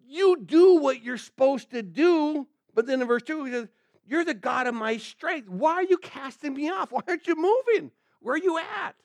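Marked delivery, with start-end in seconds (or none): 0.1-2.5 insistent demand. Acted. Acted demand addressed to God. 4.0-9.0 exasperated complaint. Acted. Psalmist asks God where he is, exasperated complaint.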